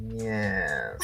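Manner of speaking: drawn-out upper class British "Yes"; upper class British accent. Shown as a demonstration. Showing friends how to open an upper class British accent. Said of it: "the more sort of upper class British".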